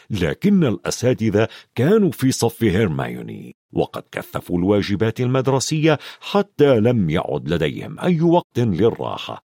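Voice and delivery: ordinary delivery, nothing marked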